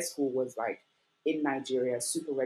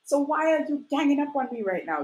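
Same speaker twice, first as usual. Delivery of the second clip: higher and louder, insisting hard; exasperated protest; 11 semitones higher, 5 dB louder than usual. Retold or imitated. Daughter protesting to upset parents she never said anything. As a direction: push back with rising exasperation.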